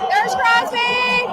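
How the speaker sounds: drawn-out excited shout. Real, not acted. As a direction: stretch the name out, thrilled. Spots a star player.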